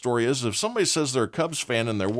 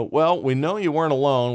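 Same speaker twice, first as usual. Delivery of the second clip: mock state trooper; 7 semitones higher, 5 dB louder than usual. Trooper questions the runaways.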